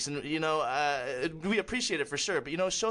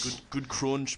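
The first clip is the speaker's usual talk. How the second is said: British bake-off judge impression; Paul Hollywood; 4 semitones lower, much slower than usual. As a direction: lower British baking-judge accent. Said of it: "doing the Paul Hollywood".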